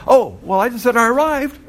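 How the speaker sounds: high, loud airy reply; mock poster reply. Teacher voicing the poster's clueless reply, high and loud.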